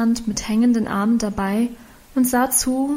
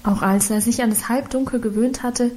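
ordinary delivery

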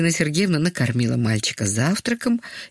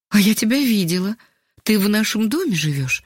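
higher voice, mildly flustered; taken aback; 6 semitones higher than usual. A narrator's reading. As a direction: say it pitched up, a little thrown. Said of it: "растерялась женщина". (слегка растерянно, повыше)

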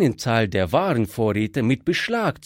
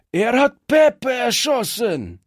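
outraged scream; 10 semitones higher than usual. A narrator's reading. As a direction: scream the accusation at the gang. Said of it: "schrie einer der Strolche". (ein Strolch schreit den anderen hoch und laut die Anklage zu)

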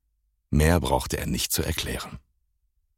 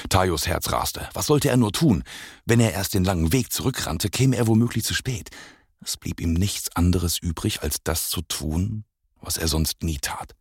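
ordinary delivery, nothing marked

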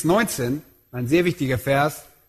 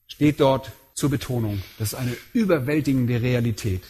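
ordinary delivery

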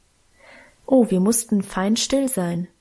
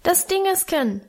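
put-on high child's squeak; small, high, insistent toddler voice; piping little boy; 8 semitones higher than usual. A narrator's reading. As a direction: pipe up in a tiny voice. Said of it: "piepste Hermann".

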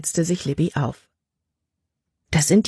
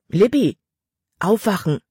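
calling to wake her; 4 semitones higher than usual. Acted. Father waking daughter from nightmare.

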